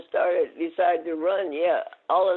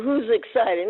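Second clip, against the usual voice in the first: raised, higher mock protest; retold yelling; 6 semitones higher, 5 dB louder than usual. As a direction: act out a loud, indignant denial. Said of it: "So I'm yelling at".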